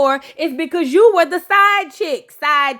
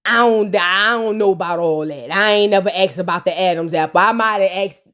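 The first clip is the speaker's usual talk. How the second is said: lower, mumbling dodgy denial; denying husband; 7 semitones lower than usual. Retold or imitated. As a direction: weasel out of it low. Kirk denying, voiced low.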